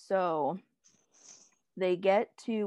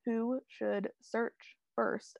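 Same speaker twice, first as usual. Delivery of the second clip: very slow, halting delivery; struggling to speak; 3 semitones higher, 4 dB quieter, much slower than usual. Real, not acted. Podcast host tripping over a recap, halting and slow. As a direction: feel for each word as it comes. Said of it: "I don't know why I can't speak".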